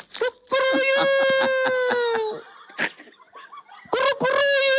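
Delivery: very high imitated cock-a-doodle-doo; long squeaky cartoon crow; rooster crow. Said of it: "Nah, turun jadi ayam".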